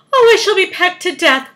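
high, loud lament; wailing. A narrator's reading. Earthworm fearing the seagulls, wailing. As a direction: bemoan a dreadful fate. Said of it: "wailed the poor earthworm".

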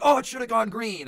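loud, high, frustrated outburst; mock angry yell. Gambler slamming the desk over a lost bet, loud mock yell. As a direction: shout it like a sore loser slamming the desk. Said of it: "slams his fist on the desk and is like".

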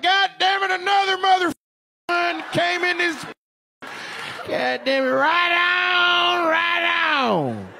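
hammed-up country yell ending in a whoop; hollering cowboy. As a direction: belt it out like a rowdy good ol' boy. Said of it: "He was like".

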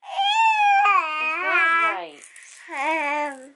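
put-on high sing-song baby voice; baby talk. Mom cooing high-pitched to her baby.

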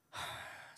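a heavy breathed sigh with no voice; deep sigh. Retold or imitated. Teacher sighing over the dropout form, breathed and weary. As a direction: let the air out in one weary huff. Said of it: "한숨을 푹 쉬시더니".

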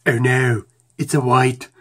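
loud Arnold Schwarzenegger-style declaration; Arnold impression. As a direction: ham up a loud Austrian action hero. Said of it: "I'm talking like Arnold".

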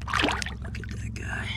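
whispered look at the fish; hushed, breathy whisper. Real, not acted. Quietly admiring released fish.

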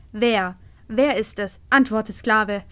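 loud hissed demand; hissing queen. Acted. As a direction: snap it at the slave. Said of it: "fauchte die Königin".

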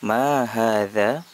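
slow, careful Arabic, hadza stressed; slow model phrase. Shown as a demonstration. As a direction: recite it slowly for pupils to repeat. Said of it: "Hadza, ini".

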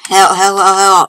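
raging gamer. Real, not acted. Frustrated while playing.

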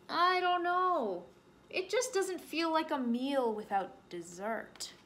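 slow, high musing; pondering bear. Acted. Bear teasing rabbit about dessert, slow musing. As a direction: stretch the doubt out slowly.

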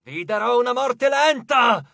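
high, slow villainous shout; screamed threat. A narrator's reading. Furious goddess threatens Percy, screaming. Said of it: "urlò".

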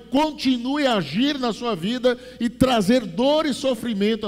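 high, loud preaching at full force; loud sermon voice; fiery preaching. Acted. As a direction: preach it hard to the congregation.

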